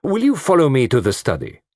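brisk, curt request; Poirot brusquely summoning the men. A narrator's reading. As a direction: order them out briskly. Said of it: "he said brusquely".

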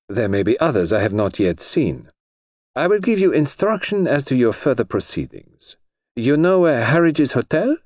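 measured, slightly foreign instructions; foreign colonel. A narrator's reading. The Colonel instructing Jane about next steps, formal.

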